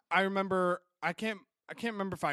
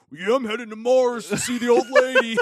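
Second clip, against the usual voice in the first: louder, higher goofy voice with a laugh; mock Timmy voice; 6 semitones higher, 11 dB louder than usual. Friend mocking Timmy's Mars trip, goofy loud voice. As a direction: ham up a buddy bragging about his space trip. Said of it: "He's like".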